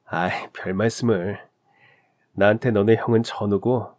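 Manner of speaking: usual speaking voice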